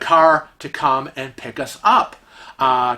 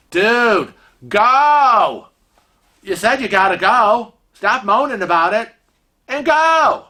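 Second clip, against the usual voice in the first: raised pitch, punchy mock exasperation; exasperated urging; 5 semitones higher, 3 dB louder than usual. Wife telling distracted husband to get going, exasperated. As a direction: push a dawdler out the door.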